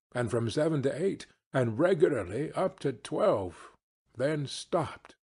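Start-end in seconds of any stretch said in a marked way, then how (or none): none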